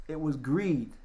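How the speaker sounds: normal talking voice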